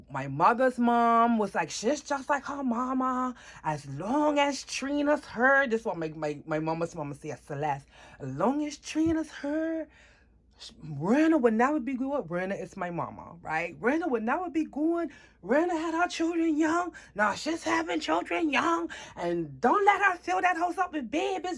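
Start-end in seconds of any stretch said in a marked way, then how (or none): 1.7-5.7 high, drawn-out mock grandmother; imitated disapproving grandmother. Mama's mama passing judgment, imitated high drawn-out disapproval. Retelling grandmother's harsh verdict. 8.2-12.3 imitated disapproving grandmother. Retelling grandmother's harsh verdict. 13.8-21.6 very high, mocking grandmother harangue; imitated scolding grandmother. Mama's mama griping about babies, imitated high-pitched scolding. Grandmother's "crazy talk" mimicked.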